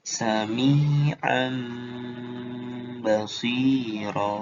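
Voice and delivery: "sami'an basiro" very slow, tanwin heard as a held M; iqlab reading. Shown as a demonstration. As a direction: hum a long M between "sami'an" and "basiro". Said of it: "jadi kayak gini hasilnya".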